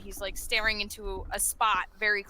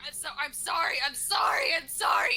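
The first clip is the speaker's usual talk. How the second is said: raised, repeated frantic apology; screaming; 4 semitones higher than usual. Acted. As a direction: shout it over and over, higher each burst. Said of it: "screaming it".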